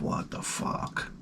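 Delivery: slow, voiceless whisper to himself; whispered thought. Retold or imitated. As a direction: whisper it slowly in disbelief, as a private thought. Acting out thinking to himself.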